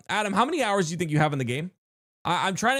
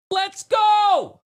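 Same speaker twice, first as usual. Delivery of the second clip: loud, high victory shout; triumphant cheer; 7 dB louder than usual. Real, not acted. Streamer celebrating the pizza donation with a loud, high cheer. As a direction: yell it like you just won.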